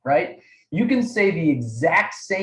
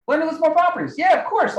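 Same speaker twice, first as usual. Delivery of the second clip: high-pitched imitation of an obvious reaction; mock dismissive agreement; 11 semitones higher, faster than usual. Coach mimicking trainees who think it simple, mock dismissive. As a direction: toss it off high, as if too obvious.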